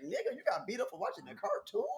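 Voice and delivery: high, amused disbelief; mock them for getting beat up over a cartoon; incredulous. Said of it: "I was like".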